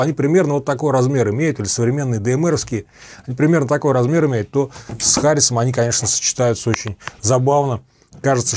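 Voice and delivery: ordinary talk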